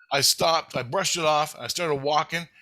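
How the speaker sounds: usual speaking voice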